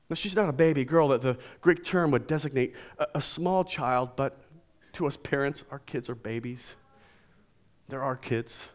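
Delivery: ordinary delivery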